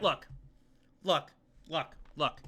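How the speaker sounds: normal talking voice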